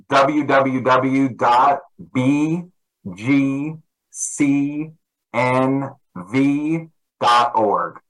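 spelled out slowly letter by letter; slow dictation. Real, not acted. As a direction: read the address out carefully, slowly. Dictating website address.

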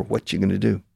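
"What you" fused as whatcha; natural contraction. Shown as a demonstration. Teacher shows native speech, "What you" as whatcha. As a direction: run "What you" into whatcha before "gonna". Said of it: "We say".

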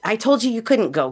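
a touch of her mother's fury, half-acted; mild mock anger. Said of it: "she lost her mind on me".

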